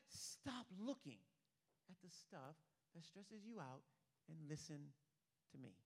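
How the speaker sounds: slow, soft, patient order; hushed command. Acted. Preacher voicing God calming the worrier, hushed and firm. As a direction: drop to a quiet, measured insistence. God's command repeated after frantic protest.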